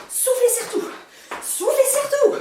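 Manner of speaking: high, loud, laughing urging; shouting. Real, not acted. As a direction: yell encouragement through laughter. Coach urging class, laughing.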